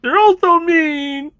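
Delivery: high, loud, slow whine; mock wail. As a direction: wail it like a hurt child.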